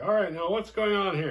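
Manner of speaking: high, loud bossy cop; hammed-up cop. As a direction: barge in like a late cop. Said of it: "It's like".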